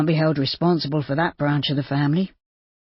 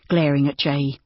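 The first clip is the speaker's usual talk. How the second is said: usual speaking voice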